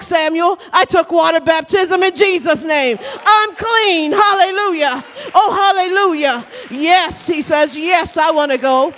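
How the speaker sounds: high, loud testimony preaching; exultant preacher retelling a convert's testimony; fervent preaching. Acted. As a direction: proclaim it with jubilant church fervor. Preaches a testimony at full force.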